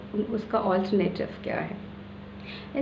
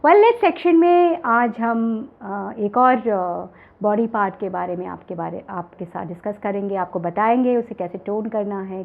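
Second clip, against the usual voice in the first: TV show host intro; 2 semitones higher, 4 dB louder than usual. Acted. Show host opens segment.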